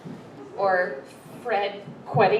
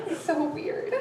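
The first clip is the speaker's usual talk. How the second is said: fascinated murmur; 6 semitones higher, 6 dB quieter, slower than usual. Retold or imitated. Fascinated viewer's aside.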